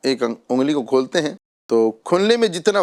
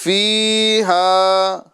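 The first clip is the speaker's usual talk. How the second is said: slow, melodic tajweed chant; Quranic recitation; 5 semitones higher, 3 dB louder, much slower than usual. Acted. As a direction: recite it measured and drawn out. Recites verse.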